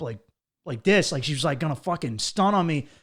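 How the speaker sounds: normal talking voice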